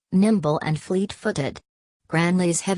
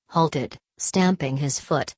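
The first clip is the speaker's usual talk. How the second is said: plain reading voice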